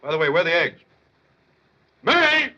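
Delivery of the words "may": high, raised shout for someone; bellowed call. Acted. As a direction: summon someone from another room. Bellows for his wife again.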